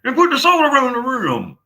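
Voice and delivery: high, loud put-on voice ordering a drink; shrill mock diva demanding a martini; hammed-up high voice; mock fancy voice.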